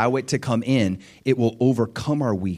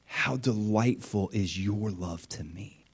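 tender; 2 semitones lower, 8 dB quieter, slower than usual. Pretend. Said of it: "he's like".

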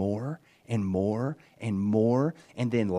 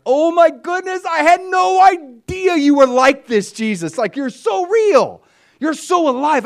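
much higher and louder, slow and ecstatic; overwhelmed joy; 14 semitones higher, 9 dB louder than usual. Retold or imitated. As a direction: cry it out in joyful wonder. Overwhelmed retold prayer to Jesus.

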